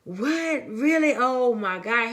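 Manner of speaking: acting out an excited husband; excited disbelief. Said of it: "He was so excited".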